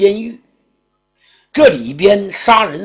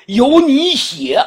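imperial command; 10 semitones higher, 6 dB louder, slower than usual. Acted. Emperor orders the edict written.

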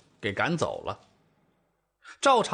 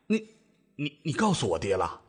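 a bit worried. A narrator's reading. Husband asks whether his father was told, worried. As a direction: stammer it out with mild worry. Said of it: "赵正大显得有些担心".

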